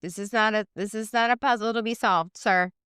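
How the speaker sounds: high no no no; playful high-pitched refusal; mock scolding.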